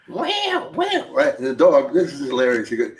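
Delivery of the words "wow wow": high mock meow wow; cat voice. Tom imitates a cat's sound in a joke, high-pitched. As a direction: squeak it like a cat. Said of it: "the cats go".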